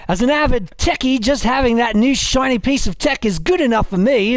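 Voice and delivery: put-on Australian accent, lively read; crocodile hunter voice. Host reads listener's battle cry, mock Australian accent. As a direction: read it out as a hammed-up Aussie. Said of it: "my crocodile hunting voice".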